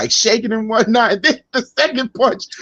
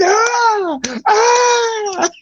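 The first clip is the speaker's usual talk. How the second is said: high hammed-up yelp of pain; mock pain cry; 14 semitones higher than usual. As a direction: yelp as if your foot was hit. McMahon's pain voiced.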